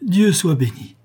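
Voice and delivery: a joyful, fervent exclamation; fervent cry. A narrator's reading. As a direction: cry it out with clasped hands. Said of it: "s'écria Clémence en joignant les mains avec ferveur".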